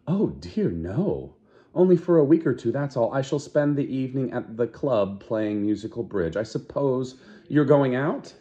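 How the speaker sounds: high, lightly fussy woman's voice; Fanny's voice. Acted. Fanny telling Lenina her plans, in a high prim chatter. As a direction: chatter on like a prim gossiping friend.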